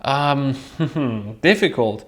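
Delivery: usual speaking voice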